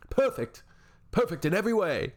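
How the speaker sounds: sarcastic mock cheer.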